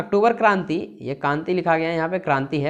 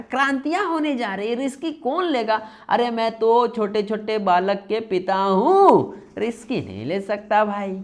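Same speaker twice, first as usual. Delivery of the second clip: pleading prime minister; 4 semitones higher than usual. Acted. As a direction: plead theatrically to be let off. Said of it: "बोल रहे हैं प्रधानमंत्री जी". (प्रधानमंत्री की नाटकीय, ऊँचे सुर में गुहार)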